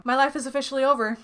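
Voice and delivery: ordinary delivery, nothing marked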